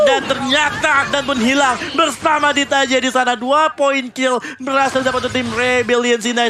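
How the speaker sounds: excited. Real, not acted. Caster calls a double kill.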